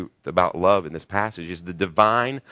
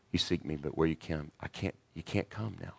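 much softer, lovingly; gentle, tender; 3 semitones lower, 10 dB quieter than usual. Retold or imitated. Jesus to his beloved disciples, soft and tender. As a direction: soothe them like your own children. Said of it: "He didn't say it like that with the disciples".

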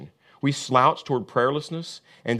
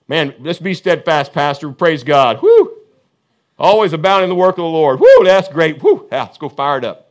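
hyped-up churchgoer cheering, whooping, much louder; mock hyped cheering; 4 semitones higher, 11 dB louder than usual. Churchgoer mocking empty hype after the sermon, whooping loud. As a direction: whoop it up like an empty pep rally. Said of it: "I hope we don't just walk out here talking about it".

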